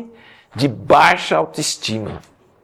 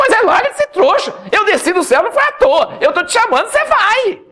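angry God. Acted. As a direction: scold him like a fed-up boss. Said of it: "Deus ficou com raiva de Moisés".

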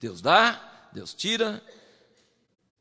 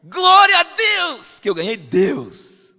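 much louder, higher, slow glad cry; joyful exclamation; 7 semitones higher, 9 dB louder, much slower than usual. Retold or imitated. Preacher voices David praising God, loud and high. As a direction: rejoice out loud over losing all. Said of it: "E aí ele fala assim".

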